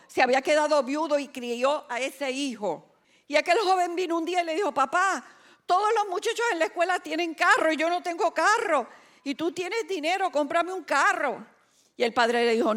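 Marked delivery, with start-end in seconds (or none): 4.7-11.5 high-pitched mock pleading; imitated pleading son. Son asking father for a car, high and insistent imitation. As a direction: press your case as an insistent son.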